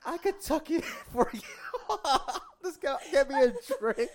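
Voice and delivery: high, laughing delivery; cracking up mid-quote. Retold or imitated. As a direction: giggle through the words. Laughing retelling of own joke.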